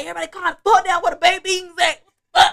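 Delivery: fast, loud, demanding; drunk yelling. Retold or imitated. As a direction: bark at the whole party. Drunk yelling for baked beans.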